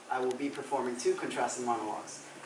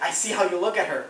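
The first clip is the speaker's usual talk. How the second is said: ordinary talk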